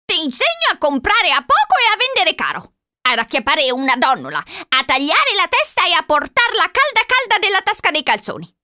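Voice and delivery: put-on high-pitched old-lady sales pitch; old woman enticing. A narrator's reading. Old woman tempting Charlie with skills, enticing. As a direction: entice him up high, item by item.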